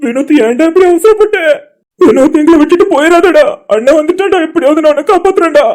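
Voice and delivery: a brother weeping as he tries to save him; desperate crying. Acted. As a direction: beg through tears.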